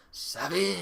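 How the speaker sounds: half-hearted try at a pirate impersonation; Jack Sparrow. Acted. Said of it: "I can't do it".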